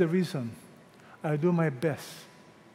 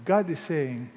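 ordinary talk, nothing marked

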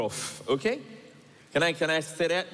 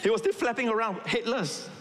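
much higher, amused storytelling; amused, excited telling; 9 semitones higher than usual. Real, not acted. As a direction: tell it with comic glee. Comic chicken story, voice up.

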